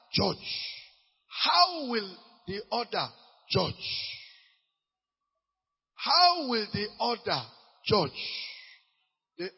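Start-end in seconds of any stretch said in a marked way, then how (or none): 1.3-3.7 slow, drawn-out question; preacher asks the question slowly. Shown as a demonstration. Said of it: "slow emphatic question". 6.0-8.2 slow, drawn-out question; preacher asks the question slowly. Shown as a demonstration.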